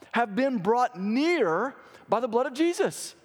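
high-pitched, emphatic preacher's delivery; fervent preaching. Real, not acted. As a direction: preach it with rising fervour, pitch lifted. Preaches at rising force.

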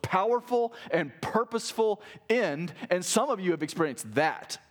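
high-pitched, driving sermon delivery; impassioned preaching. Acted. Preacher urging a suffering congregation to persevere, impassioned. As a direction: preach it urgently, pitched well up.

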